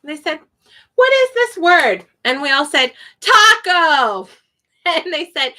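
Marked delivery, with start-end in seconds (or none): 0.9-2.1 higher-pitched mock teacher question; quizzing teacher. Acting teacher quizzing the class. 3.2-4.3 high, eager classroom answer; mock class answer. Acting class answering in chorus.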